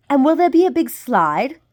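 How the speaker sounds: high, loud eager question; excited child. A narrator's reading. Maddie asking the crocodile about slides, high and eager. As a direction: ask it bouncing with excitement. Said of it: "Maddie said".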